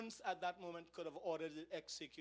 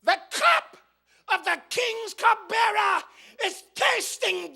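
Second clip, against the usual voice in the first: shouted, much higher, slow then driving sermon peak; preaching at full force; 11 semitones higher, 16 dB louder than usual. Acted. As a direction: hammer it home to the congregation. Preaching climax on the cup.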